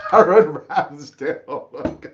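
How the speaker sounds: can't keep a straight face saying it; name the keeper while cracking up; laughing. Real, not acted. Laughs around the announced name.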